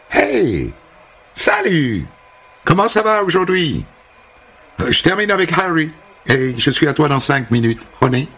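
high, bubbly American welcome; chirpy American hairdresser. Acted. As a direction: greet him like an eager stylist. La coiffeuse américaine accueille Sammy. (coiffeuse américaine enjouée, voix haute)

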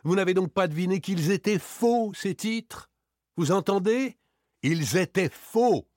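high, emphatic outburst of indignation; exasperated. Acted. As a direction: drive the revelation home, outraged. Outraged revelation to the friend. (exaspéré, indigné)

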